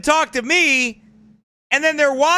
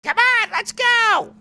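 much higher, slow, mock-shouted wife voice; bossy wife; 10 semitones higher, slower than usual. Wife ordering husband to leave, high mock shout. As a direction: play the wife dragging him away. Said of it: "men always listen to their woman".

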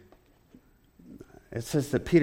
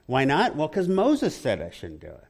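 ordinary delivery

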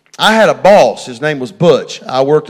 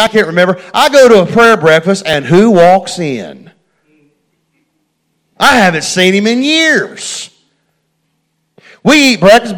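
loud, high, driving preacher voice; full-force preaching; 7 semitones higher, 8 dB louder than usual. Acted. Preacher telling the church about meeting Butch, loud and high.

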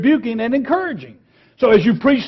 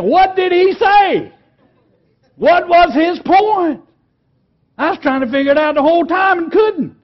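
confused listener; 10 semitones higher, 4 dB louder than usual. Retold or imitated. Confused listener after a sermon.

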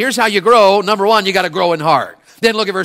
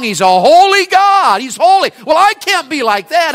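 high, loud, slow cry; preaching cry; 10 semitones higher, 6 dB louder than usual. Real, not acted. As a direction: shout it out to the church. Preaching climax.

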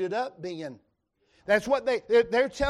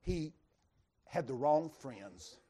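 a low hushed punchline; hushed, slow reveal; 5 semitones lower, 6 dB quieter, slower than usual. Real, not acted. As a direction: drop low and soft, slowly. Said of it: "I don't want you to miss this".

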